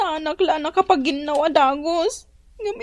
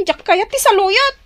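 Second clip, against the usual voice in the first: louder, stubborn no; sulky refusal; 7 dB louder than usual. Acted. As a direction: refuse loudly like a spoiled son. Child refuses food, argues.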